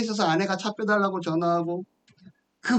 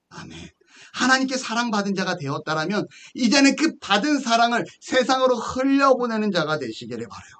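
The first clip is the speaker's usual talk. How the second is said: ordinary talk, nothing marked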